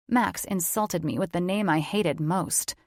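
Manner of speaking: plain reading voice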